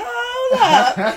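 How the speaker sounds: high, loud double take; mock astonishment. She pulls the phone back at his voice, hammed-up astonishment. Retold shock at his deep voice.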